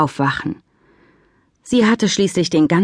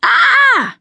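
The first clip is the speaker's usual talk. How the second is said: a shrill, much higher scream; piercing scream; 5 dB louder than usual. Acted. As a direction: scream out in alarm. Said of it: "ertönte ein gellender Schrei".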